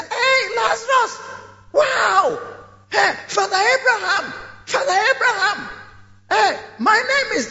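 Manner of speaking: high desperate shout across the gulf; shouted call. Acted. Rich man shouting across the gulf to Abraham, high and desperate. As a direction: yell to be noticed, pleading.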